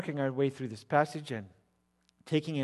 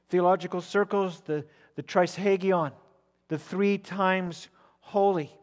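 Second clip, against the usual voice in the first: ordinary talk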